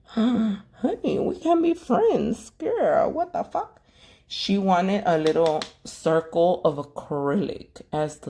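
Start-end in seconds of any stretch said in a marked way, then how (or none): 0.1-3.8 much higher, slow sassy squeal; high-pitched sassy disbelief; mock outraged diva. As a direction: play the scandalised girlfriend, way up high. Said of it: "And I was like".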